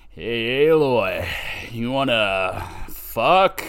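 slow, drawn-out mocking come-on; irritating voice. Reviewer mimicking Erend propositioning Aloy, slow and irritating. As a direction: imitate an irritating suitor, stretching every word. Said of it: "He's even got an irritating voice".

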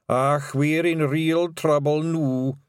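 slow, high Scots dread; worried Scots pixie, drawn out; Daft Woolly. Acted.

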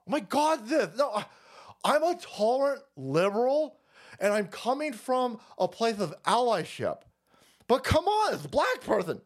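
high, whiny pearl-clutching liberal; mock liberal voice. Comic mocking a liberal ally, high whiny voice. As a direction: squeal it like a smug ally.

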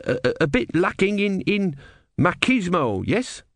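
high, hesitant youngster; young novice. A narrator's reading. Young novice doubting that a god would be a swan, hesitant. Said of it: "said a young novice".

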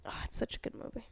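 hushed whisper. Real, not acted. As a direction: whisper it like a secret. Unvoiced aside about the movie.

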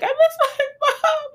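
hammed-up sob-talk through "I miss my mom"; mock crying talk. Shown as a demonstration. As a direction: blubber the words the way you talk while crying. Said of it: "the cry while you're talking".

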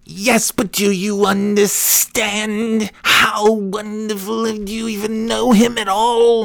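high, slow church-lady wail; mock yelling woman. Church woman accosting the preacher's son, mock yelling. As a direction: stretch it out in hammed-up high indignation. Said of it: "coming up and yelling at me".

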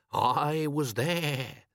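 very slow, stretched-out announcement; self-important boast. A narrator's reading. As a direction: draw it out grandly for the grandchildren. Imagined boast to grandchildren.

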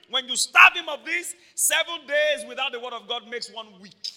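loud, high preaching, pushing every line; full-force preaching. Acted.

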